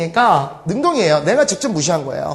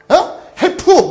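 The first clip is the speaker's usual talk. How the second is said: mock cry of help; 12 semitones higher, 5 dB louder, slower than usual. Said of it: "막 이런 거야".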